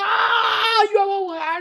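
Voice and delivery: high wailing imitation of a child; mock bawling. Eldest protests being wrongly blamed, bawling in mock tears. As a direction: wail the protest like a wronged, blubbering kid. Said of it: "鼻涕泡带着大米粒儿都飞出来了".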